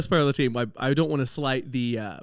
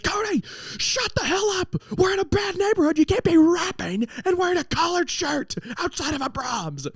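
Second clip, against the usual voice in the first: much higher, panicked angry coach yelling; mad coach. Retold or imitated. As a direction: scold him like a furious, scared coach. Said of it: "we had never seen him that mad".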